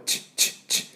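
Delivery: unvoiced kiss-like チッ; blown kiss ch. Shown as a demonstration. Teacher showing the end of beach as a kiss sound. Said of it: "投げキッスの時のチッ".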